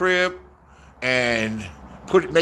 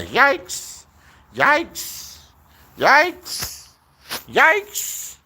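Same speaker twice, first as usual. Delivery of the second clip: each yikes higher than the last; mock alarm going higher and higher; rising yikes; 10 semitones higher, much slower than usual. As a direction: climb up in pitch with every yikes. Mocking alarm, rising each time.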